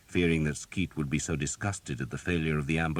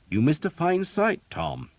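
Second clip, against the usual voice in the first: bright cheerful priest, pitched higher; beaming, glad to tell the news; a jolly priest greeting the runaway; 12 semitones higher, 6 dB louder than usual. A narrator's reading. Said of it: "he said cheerfully".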